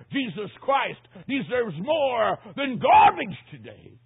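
high, slow, loud declaration; stretched, emphatic pulpit shout; full-force preaching. Real, not acted. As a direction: proclaim it to the whole congregation, shouting.

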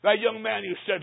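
normal talking voice

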